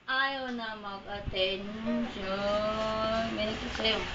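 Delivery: quiet aside. Real, not acted. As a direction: say it under your breath. Soft aside.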